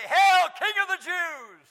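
loud, slow jeering hail; mocking soldiers. Preacher voicing soldiers mocking Jesus with a loud jeer.

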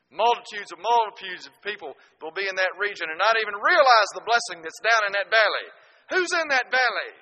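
loud, high-pitched pulpit cry; full-force preaching. Real, not acted.

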